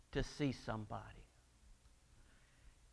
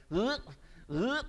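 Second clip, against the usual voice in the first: imitated fish retching; 6 semitones higher than usual. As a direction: heave like a fish about to spit someone out. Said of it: "when the fish started going".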